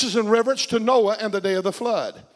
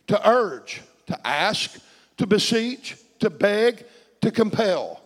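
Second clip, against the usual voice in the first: ordinary talk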